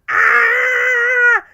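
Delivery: very high, very loud cartoon shriek; Tom and Jerry scream. Fan recalling a Tom and Jerry cartoon, cartoon shriek. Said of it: "Tom and Jerry going".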